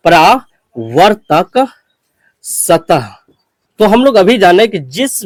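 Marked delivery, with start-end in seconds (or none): 0.1-3.1 परावर्तक drawn out very slowly, then a pause before सतह; slow dictation. Shown as a demonstration. Teacher dictating a term for students to write down, very slow. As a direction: dictate परावर्तक syllable by syllable while writing it on the board. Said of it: "तो हम इस बात को लिखते हैं यहाँ पर".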